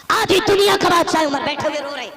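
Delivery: soaring pulpit climax; fiery preaching. Acted. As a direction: build to the climax. Preaching at full force.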